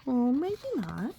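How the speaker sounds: plain reading voice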